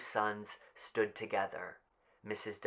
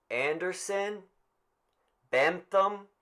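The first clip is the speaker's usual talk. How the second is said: calling out names; 9 semitones higher, 4 dB louder than usual. Acted.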